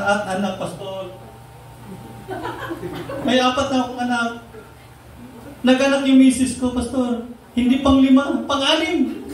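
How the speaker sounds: hammed-up weepy complaint from an overwhelmed dad; imitating a crying father, played for laughs; retold tearful father. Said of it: "Tapos umiyak siya sa akin".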